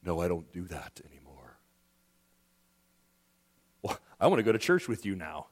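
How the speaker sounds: voiced low and soft, a smug refusal then an eager reply; mock exchange. Pastor mocks the preachy believer to his congregation, in a low put-on voice. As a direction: play both sides of the sarcastic exchange, quietly.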